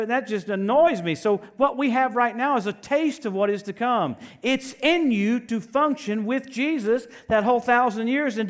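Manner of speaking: preach. Acted. Preacher urges congregation to rise up and walk, forceful preaching. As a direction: drive it home to the congregation.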